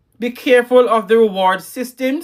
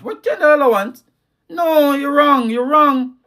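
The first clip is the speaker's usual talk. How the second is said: a much higher pitched no, you're wrong; incredulous rebuke; 6 semitones higher than usual. Acted. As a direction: scoff at the very idea, then correct them. Rebuts parent paying child allowance.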